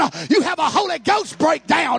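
high, loud preacher shouting to the church; full-force preaching. Acted. Preacher shouting to the congregation about the Holy Ghost, full force.

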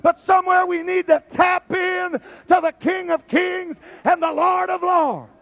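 fiery preacher shouting; preaching. Acted. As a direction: preach it at full force. Preaching at full force.

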